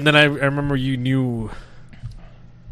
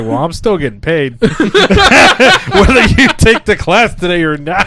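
put-on laughing through a loud, slow Indian accent; slow, loud Indian accent with laughter; 8 semitones higher, 10 dB louder, slower than usual. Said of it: "Insert Indian accent".

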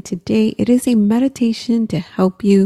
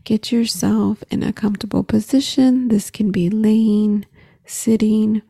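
guided meditation; 3 dB quieter than usual. Acted. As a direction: gently guide the breathing. Leads a guided breathing meditation.

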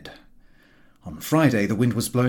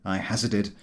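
ordinary delivery

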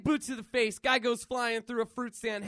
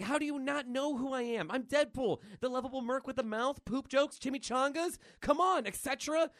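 higher-pitched comic disbelief; indignant boast; 6 semitones higher than usual. Acted. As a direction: protest as a famous hero unrecognised. Deadpool indignant he isn't recognised.

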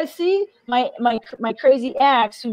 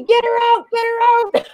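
much higher, mock alarmed call for security; panicked staff voice; 12 semitones higher than usual. Nurse calling security on her, mock alarmed high voice. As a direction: play the frightened nurse shooing her. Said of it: "I called security".

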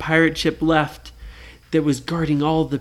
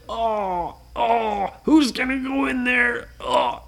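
nervous pirates; 7 semitones higher, slower than usual. Acted. Pirates afraid to go in.

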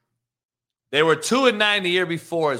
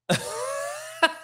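laugh; 6 dB quieter than usual. Real, not acted. Laughs at interview question.

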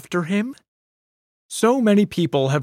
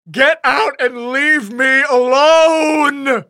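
slow, high, loud desperate scream; 12 semitones higher, 7 dB louder, much slower than usual. Acted. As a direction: scream at her to get out. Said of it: "he screamed".

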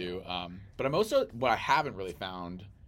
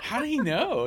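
mock amazement; 8 dB louder than usual.

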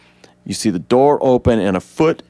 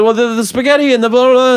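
high, fast goofy babble; rambling Barry; 14 semitones higher, 5 dB louder, faster than usual. Retold or imitated. Barry babbling to reporters, high goofy voice. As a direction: gush out nonsense in a squeaky voice. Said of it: "And he said".

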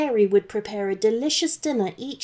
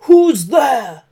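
loud, higher dragon shout; 4 semitones higher, 11 dB louder than usual. Acted. Dragon barging into the prisoner's room, shouting. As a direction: bellow it at the door. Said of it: "shouted the dragon".

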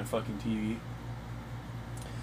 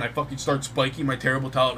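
ordinary delivery

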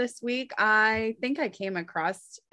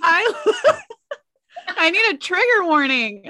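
laughing; 10 semitones higher, 5 dB louder than usual. Real, not acted. Reacting to the question.

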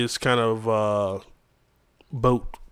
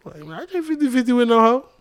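mock greedy date; 11 semitones higher, 5 dB louder than usual. Imitating a date ordering pricey things, higher and louder.

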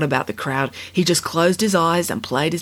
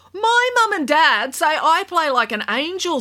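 put-on high, proud young girl; 11 semitones higher than usual. A narrator's reading. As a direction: boast like a hurt kid. Kat defends her playing.

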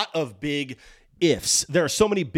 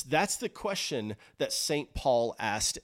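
ordinary talk, nothing marked

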